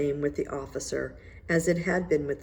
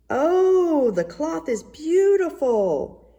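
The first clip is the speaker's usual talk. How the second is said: much higher, louder, slow exclamation; cried out loud; 11 semitones higher, 6 dB louder, much slower than usual. A narrator's reading. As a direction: proclaim it loudly so everyone hears. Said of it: "he cried out loud".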